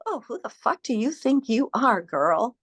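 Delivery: mock put-down from her own ego. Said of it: "and then ego".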